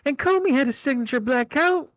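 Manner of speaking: townsperson voice. Acted. Townsperson telling the sheriff what they saw, in a high put-on voice. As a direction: squeak it out like an excited witness. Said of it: "The townsperson one".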